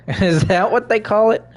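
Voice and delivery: high, loud laughing question. Real, not acted. Laughing mockery at character.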